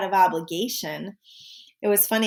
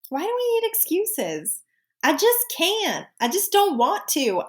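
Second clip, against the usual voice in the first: high, drawn-out Southern twang; put-on Southern belle lilt, pitched high; Southern accent; 10 semitones higher than usual. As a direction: stretch it into a playful Southern accent. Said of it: "Why do I always have a Southern accent?".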